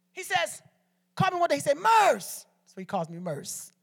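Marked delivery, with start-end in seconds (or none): 1.8-2.5 brother's call. Retold or imitated. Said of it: "this is how he talk".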